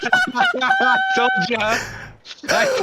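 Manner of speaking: laughing through the words, slow; told-you-so between laughs. Real, not acted. Friend laughs at the map.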